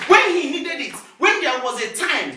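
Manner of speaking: high, loud preaching burst; forceful pulpit emphasis; emphatic proclamation. Real, not acted.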